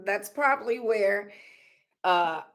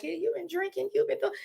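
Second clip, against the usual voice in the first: much higher, softer mock voice of people; teasing onlookers; 11 semitones higher, 7 dB quieter, much faster than usual. Mocking people who ask if she's drunk, higher and light. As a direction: lightly mimic someone asking if she's drunk. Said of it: "Sometimes people be like".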